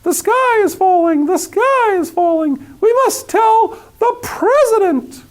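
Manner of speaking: high, slow squawking chicken panic; clucking hen. A narrator's reading. Hen clucking her alarm to the world, high and frantic.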